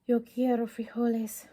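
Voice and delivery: lightly voiced somber declaration. Don Diego declaring to the crowd, mildly somber. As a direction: declare it with a touch of gravity. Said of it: "in his most somber voice declared".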